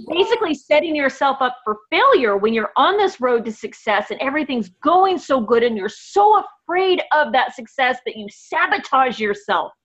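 ordinary delivery

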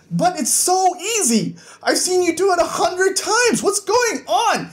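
very high, frustrated daughter voice; exasperated protest. Acted. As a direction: protest in mock exasperation, pitch way up. Daughter's escalating quarrel, acted out.

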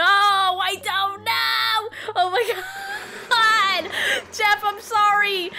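high, drawn-out whining cry; despairing wail. Real, not acted. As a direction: wail helplessly, apologising as you fail. Despairing, apologising to hostage Jeff.

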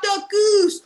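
high, loud shout of the game name; shouted game call. Acted. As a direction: call it out across the room. Announcing the game loudly.

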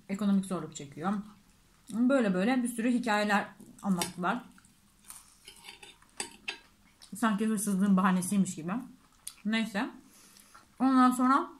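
talking while chewing food; eating finger food while speaking; mouth full, chewing. Real, not acted. Seen 3: Curly-haired speaker holds food by hand, bites and chews mid-sentence.

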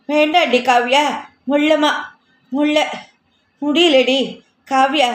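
mild scared pleading while being dragged; frightened plea. Acted. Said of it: "என்று பயந்தபடியே".

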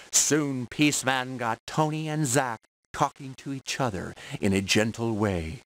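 plain reading voice

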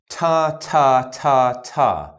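crisp "ta" with the tongue tip; ta drill. Shown as a demonstration. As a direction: tap out each "ta" cleanly. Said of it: "we'll do it with the letter T".

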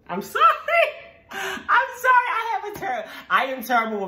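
high, loud apology through laughter; laughing apology. Real, not acted. Host failed the British accent, laughing apology. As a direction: crack up while saying sorry.

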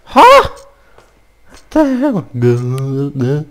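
high shriek, drawn out slowly; startled scream. Real, not acted. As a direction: shriek in fright at a jump scare. Shrieks at jump scare.

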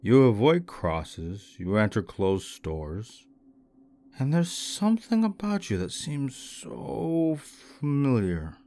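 put-on slow, drawn-out drunken musing; drunken slur. A narrator's reading. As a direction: work it out aloud, drunk and dragging every word. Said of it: "the drunken young man said".

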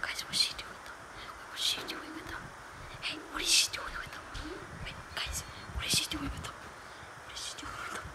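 sneaky unvoiced whisper to viewers. Real, not acted. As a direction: whisper the plan so nobody hears. Sneaking, watching the villain.

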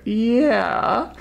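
high, drawn-out doubtful yeah; string out a placating yeah, rising high; humoring him. Retold or imitated. Everyone lets the dying man have it, humoring. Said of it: "And everyone's like".

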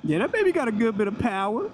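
high, excited whooping; thrilled. Real, not acted. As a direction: shout with the thrill of speed. Thrilled after hard acceleration.

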